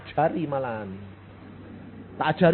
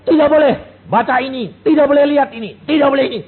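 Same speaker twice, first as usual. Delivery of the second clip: strict parent forbidding; 11 semitones higher, 11 dB louder, faster than usual. Retold or imitated. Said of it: "tekan-tekan bilang".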